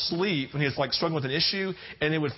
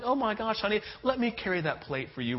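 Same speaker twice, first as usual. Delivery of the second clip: super sweet; 6 semitones higher than usual. Retold or imitated. Guilty husband fussing over wife, sugary sweet. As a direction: lay on the sweetness. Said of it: "super sweet and super nice".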